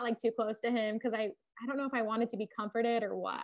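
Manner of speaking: ordinary talk, nothing marked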